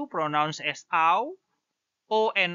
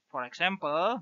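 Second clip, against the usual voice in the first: ordinary talk, nothing marked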